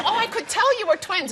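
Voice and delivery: high, laughing imitation of a gushing fan; mimicking a gushing fan. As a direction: poke fun at the gushing stranger. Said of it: "And they say".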